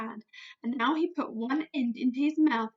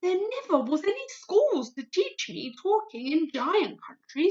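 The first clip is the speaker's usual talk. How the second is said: higher, rustic giant voice; big friendly giant voice; 5 semitones higher, 3 dB louder than usual. Acted. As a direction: play a kindly clumsy giant. BFG voice in read story.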